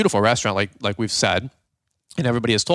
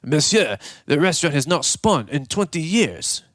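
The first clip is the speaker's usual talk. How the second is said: mock French waiter, pitched up; French waiter accent; 4 semitones higher than usual. French waiter breaking it to diners, mock French accent. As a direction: answer as a put-on French waiter. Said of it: "Frenchie comes back and he goes".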